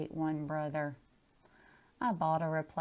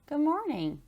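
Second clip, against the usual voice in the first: bright, higher teacher greeting; cheery teacher; 9 semitones higher, 3 dB louder than usual. Acted. Teacher greets a dripping pupil on the first day, cheery. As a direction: greet her like a beaming teacher.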